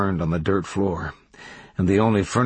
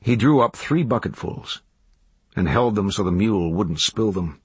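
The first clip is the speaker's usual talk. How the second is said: ordinary delivery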